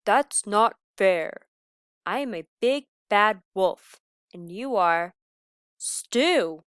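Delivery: loud slow protest; indignant wolf. Acted. Big bad wolf insists to the lamb, loud. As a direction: stomp it out loudly, word by word.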